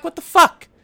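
loud, high furious yell; angry outburst. Retold or imitated. Confronting lying managers, yelling loud and high. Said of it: "I was pissed off because I was like".

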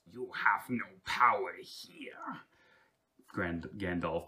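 slow, unvoiced villain whisper; a dark sorcerer defying Gandalf in a whisper; whispered villain quote. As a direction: hiss the threat to the wizard. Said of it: "in Lord of the Ring, I have one".

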